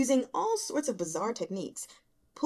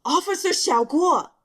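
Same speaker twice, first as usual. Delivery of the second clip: loud, higher call of the name; fed-up shout across the room; exasperated shout; 7 semitones higher, 9 dB louder than usual. A narrator's reading. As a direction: bark his name in frustration. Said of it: "on the verge of a cold sweat".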